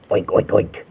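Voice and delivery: breathy unvoiced oinks; pig oinks. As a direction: grunt like a desert pig. Imitating javelinas.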